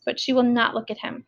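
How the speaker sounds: usual speaking voice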